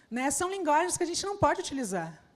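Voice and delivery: ordinary talk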